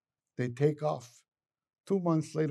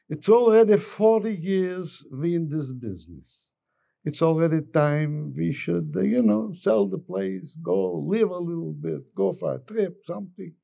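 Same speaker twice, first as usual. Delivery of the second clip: loud, higher-pitched shopkeeper with broken English; animated joke character, pitched up; old shopkeeper; 4 semitones higher, 7 dB louder than usual. Acted. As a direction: plead for a break after years of work.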